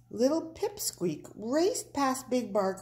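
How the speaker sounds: ordinary delivery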